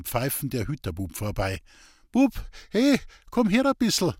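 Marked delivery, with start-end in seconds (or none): 2.1-4.1 high, raised hailing voice; calling out. A narrator's reading. Sick man calls passing boy to window, raised call. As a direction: hail someone going past.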